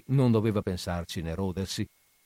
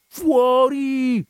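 much higher, louder shout of Fuori; roaring Fuori at the musician; full-breath yell; 8 dB louder than usual. A narrator's reading. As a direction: bellow him out of the office with all your breath. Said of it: "urlò il padrone con tutto il fiato".

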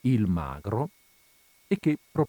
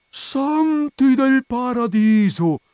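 high, loud, drawn-out exclamation; amazed; 9 dB louder, much slower than usual. Acted. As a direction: marvel out loud in sheer astonishment. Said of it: "si meravigliò il magro". (grido acuto di meraviglia)

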